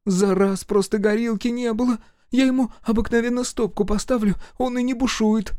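высокий голос матери, оправдывается; мать торопливо уговаривает сына. A narrator's reading. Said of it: "мать". (высокий умоляющий голос матери)

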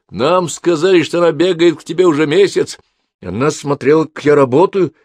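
high, loud menacing outburst; shouted. Acted. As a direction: yell it at him, threatening. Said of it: "выкрикнул брат Гулии".

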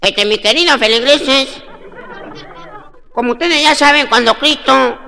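high, loud, drawn-out pulpit voice; slow, high-pitched sermon delivery; preaching priest. Acted. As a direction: address the flock like a loud parish priest.

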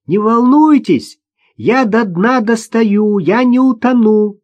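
put-on high, slow, loud child call; little girl's voice. A narrator's reading. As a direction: reassure from afar in a child's voice. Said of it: "раздался голосок Маши". (высокий детский голосок, протяжно)

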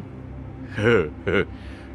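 sheepish, soft covering laugh; awkward laugh. A narrator's reading. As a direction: laugh off the embarrassment. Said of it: "cười chữa ngượng".

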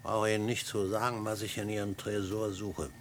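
gedämpft und bedrohlich; ruhig, leiser Ton mit Druck; leise drohend. Acted. Leise Drohung mit Pistole. (leise Drohung an den Professor am Tresor)

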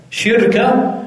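شركة said slowly as shirka, sh with i and the r silent; shirka pronunciation. Shown as a demonstration. Teacher dictating the third form to students, slow shirka. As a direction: stretch out شركة as shir-ka. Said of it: "بكسر الشين وسكون الراء".

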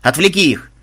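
sharp acted order; barked. A narrator's reading. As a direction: bark the order. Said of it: "рявкнул Бонзай".

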